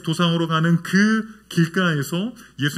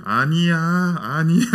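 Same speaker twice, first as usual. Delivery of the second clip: imitated toddler sleep-talk; 3 dB louder than usual. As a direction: mimic a small child protesting while asleep. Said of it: "잘 때 가끔 그래요".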